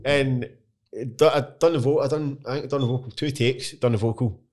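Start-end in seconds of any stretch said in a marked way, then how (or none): none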